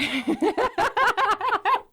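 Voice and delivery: a high laugh bursting out; real laugh, rising high. Real, not acted. Laughing at bear memory.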